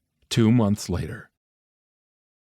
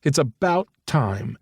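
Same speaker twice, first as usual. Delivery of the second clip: mother's voice; 6 semitones higher, slower than usual. Acted. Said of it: "she called him and said".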